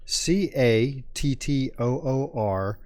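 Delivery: slow, letter by letter; spelling out. Shown as a demonstration. Host spells the player's surname for listeners, spelling out slowly. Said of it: "His last name is spelled".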